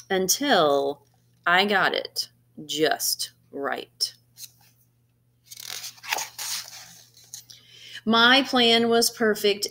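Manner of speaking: animated picture-book narration with gentle pauses; storybook reading. Acted. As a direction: read it like story time for a class. Reads picture book to students.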